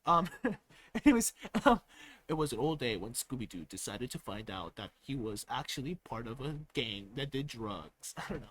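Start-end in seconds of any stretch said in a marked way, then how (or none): 2.3-8.1 mock narrator voice. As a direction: narrate it low like a mystery show. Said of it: "loudness out of my voice".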